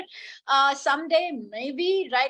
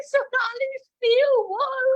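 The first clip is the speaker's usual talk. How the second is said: high-pitched, exaggerated disbelief; mock scandalised friend; 11 semitones higher, 4 dB louder, slower than usual. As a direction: imitate a friend scolding in shocked amusement. Acts out friend's appalled text.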